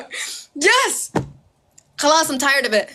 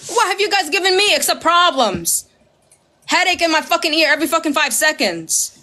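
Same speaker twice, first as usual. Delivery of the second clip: normal talking voice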